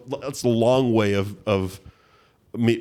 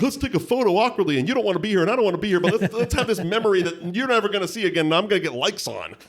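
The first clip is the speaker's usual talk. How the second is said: mock awkward fan; 10 semitones higher, faster than usual. Mock awkward selfie request.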